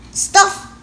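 high, loud scoff; indignant high exclamation; scoffing emperor. Acted. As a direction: blurt it out like an outraged emperor.

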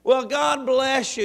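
ordinary delivery

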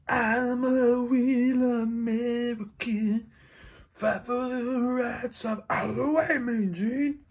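high, very slow entrance-song growl; Hulk Hogan. Acted. Dad playing the Hulk toy, slow, high entrance bravado. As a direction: strut in and sing the theme slowly. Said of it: "all you Hulkamaniacs".

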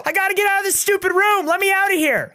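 a stretched, high cry, then frantic pleading to be let out; mock panic.

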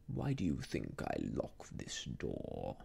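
low, slow, veiled menace; smiling villain. A narrator's reading. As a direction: question her with quiet threat. Rucastle voiced by reader.

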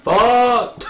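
loud, high burst of frustration; frustrated yell. Real, not acted. Player after a setback in game, frustrated yell. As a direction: yell the curse out, high and sudden.

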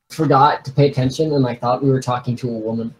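ordinary talk, nothing marked

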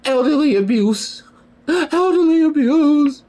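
gasping high falsetto, words stretched slow; mock-horrified high squeal with gasps; mocking mom. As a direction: mimic a scandalized mother, squealing slowly. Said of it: "the, her nerve she had to tell me".

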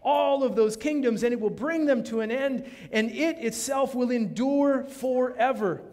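loud, high scripture reading, hammered home; fiery pulpit reading; preaching at full force. Acted. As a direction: proclaim it to the whole hall.

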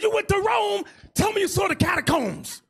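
retold disbelief at a wasted trip to Rome; incredulous excitement. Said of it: "And I said".

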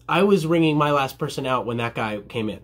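ordinary delivery

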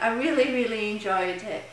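usual speaking voice

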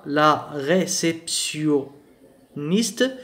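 very slow, drawn-out French word; syllable by syllable. Shown as a demonstration. Teacher sounding out a word for French learners, syllable by syllable.

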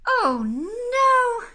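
loud, high, stretched wail; exasperated Mister Bear. Acted. Sleepless bear hears aeroplane noises, loud exasperated wail. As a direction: cry it out in dismay.

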